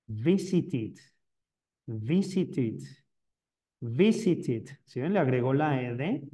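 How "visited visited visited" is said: "Visited" ending in a short "it", "visit-it"; teacher models "Visited" as "visit-it". Shown as a demonstration. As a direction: drill "Visited" slowly, landing on the final "it". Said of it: "En pasado sonaría así".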